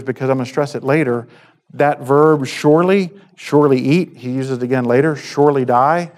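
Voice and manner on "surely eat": usual speaking voice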